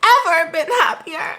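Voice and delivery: high, loud, theatrical declaration; hammed-up drama heroine shouting her defiance, very high; Cassie from Euphoria. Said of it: "is that Cassie from Euphoria?".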